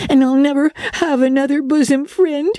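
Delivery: put-on very high, wailing little-girl voice; sobbing girl. A narrator's reading. Diana swears love to her bosom friend, sobbing wail. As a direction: wail it as a tearful child. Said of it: "sobbed Diana".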